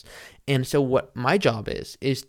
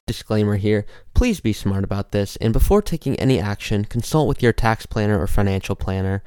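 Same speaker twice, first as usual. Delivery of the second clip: lower, louder disclaimer read; fast legal disclaimer; 4 semitones lower, 6 dB louder than usual. Acted. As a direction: rattle off the legal fine print.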